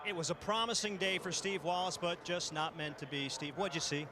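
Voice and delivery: usual speaking voice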